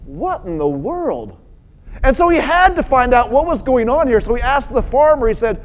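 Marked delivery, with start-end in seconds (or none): 0.0-1.3 soft, low, slow bafflement; slow, hushed puzzlement; puzzled wondering. Acted. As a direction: wonder it quietly to yourself. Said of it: "was wondering".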